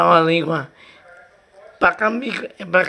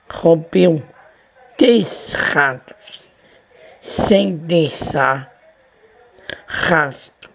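slow tongue twister; slower than usual. Acted. Slow careful tongue twister attempt.

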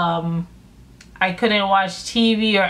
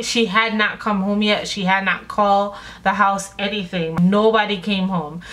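ordinary delivery

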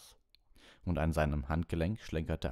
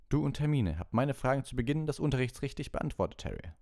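ordinary delivery

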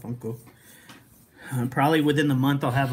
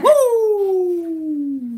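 high, loud wrestler's whoop; Ric Flair; 6 dB louder than usual. Acted. Cheering a viewer's sub count, Ric Flair whoop. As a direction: let out a showy, high "woo". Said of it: "Give him that Ric Flair".

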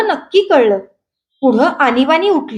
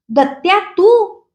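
higher, slow, drawn out in disbelief; surprised recognition; 5 semitones higher, slower than usual. A narrator's reading. Narrator greets an old friend at his door, surprised and slow. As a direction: stretch it out, amazed to see him.

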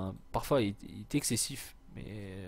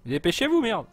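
loud, higher burst of impatience; impatient shout; 10 semitones higher, 8 dB louder than usual. Real, not acted. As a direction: yell at them to hurry. Hurries his troops in game.

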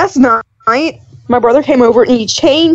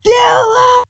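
high mock yell; retold scream; 12 semitones higher than usual. Brother's scream heard on the voicemail, high mock yell. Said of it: "you can just hear him scream".

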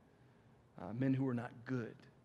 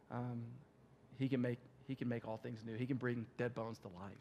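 softer, gentle, sincere telling; tender, sincere; 5 dB quieter than usual. Retold or imitated. Pastor gently telling Melissa about Jesus, softly.

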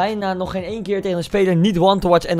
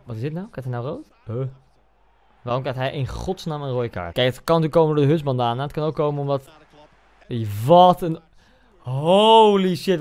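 normal talking voice

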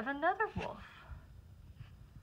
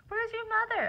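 gentle high question; 12 semitones higher than usual. Acted. Wolf finds lost cub.

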